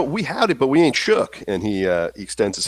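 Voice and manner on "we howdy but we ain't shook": high, folksy Texan voice; Texan accent. Acted. Slim offering a handshake to the stranger, folksy Texan accent. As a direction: pitch it up, Texan and neighborly. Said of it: "with your Texan accent".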